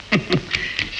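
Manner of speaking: soft, short chuckle; amused chuckle. Acted. Suitor teasing a shy woman, soft chuckle. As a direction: chuckle softly at her shyness.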